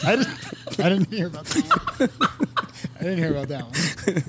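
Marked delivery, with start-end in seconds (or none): 0.0-2.1 words squeezed out through laughter; helpless laughter. Real, not acted. Co-host hearing the rejected show name, cracking up. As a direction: try to talk while cracking up.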